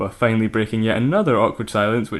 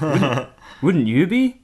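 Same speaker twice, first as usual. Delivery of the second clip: slow, high rising take with a stumble; a slightly botched first go; flubbed line; 3 semitones higher, much slower than usual. Acted. Samantha on Jabba's pride, first slow flubbed take. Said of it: "Ah, sorry".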